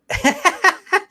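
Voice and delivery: short high burst of laughter. Real, not acted. Vlogger laughs at himself while thanking viewers, high laugh.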